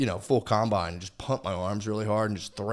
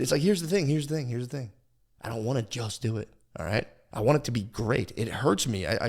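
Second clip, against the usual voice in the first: usual speaking voice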